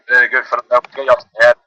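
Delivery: usual speaking voice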